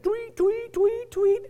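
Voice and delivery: high-pitched "tweet" noises; quick high electronic chirping, imitated; imitated phone chirps. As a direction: mimic a phone going off over and over. Imitates phone alert sounds.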